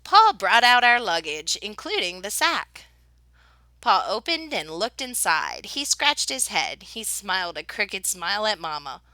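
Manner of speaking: ordinary delivery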